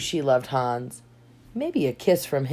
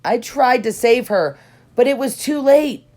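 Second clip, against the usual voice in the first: high, louder fake regret; mock sorrow; 7 semitones higher, 5 dB louder than usual. A narrator's reading. Hans lies to Elsa, louder with put-on sorrow. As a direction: lie about it with put-on sorrow. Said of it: "Hans said to Elsa".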